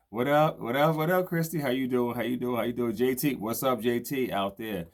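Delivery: high, loud, rapid-fire hellos; excited shout-outs. Real, not acted. Host calls out to viewers popping into the stream, loud and high. Greeting viewers joining the live.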